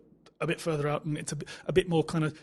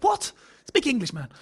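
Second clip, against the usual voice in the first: mock bafflement; 8 semitones higher than usual. Acting out bafflement at partner's dad.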